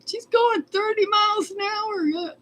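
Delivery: high, put-on voice of the guy in the car; mock the driver calling out her speed; imitated yell. Guy in the car calling after the runaway horse, high and mocking. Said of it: "the guy yells out".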